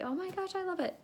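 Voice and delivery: high-pitched mock gushing; gushing customer. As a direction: imitate a delighted customer. Customer's delighted reply repeated.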